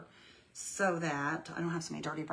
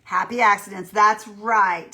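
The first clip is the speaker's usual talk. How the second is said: cheerful exclaim; 12 dB louder, slower than usual. Real, not acted. Chat viewer's comment read out.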